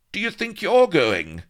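high, loud challenge; indignant demand. A narrator's reading. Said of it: "she demanded".

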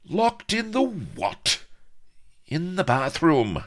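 very high, drawn-out disbelief and retort; incredulous confrontation. A narrator's reading. Avery and Blood in incredulous confrontation, high and snappish. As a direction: stretch it out, high and affronted. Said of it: "snapped Blood".

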